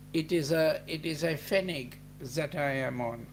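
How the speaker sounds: normal talking voice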